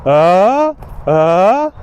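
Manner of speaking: "Uh" rising like a startled question; shocked uh. Shown as a demonstration. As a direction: react shocked, sliding up. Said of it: "someone says something shocks you".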